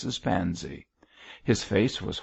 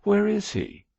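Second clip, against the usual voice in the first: indignant; 5 dB louder than usual. A narrator's reading. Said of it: "indignantly turning away from George's wink".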